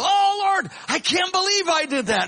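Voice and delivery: hammed-up outcry at himself; shocked dismay. Recalling shock after sinning.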